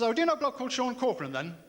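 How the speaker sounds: a hint of a chirpy bloke asking hopefully; eager pub stranger. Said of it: "He says".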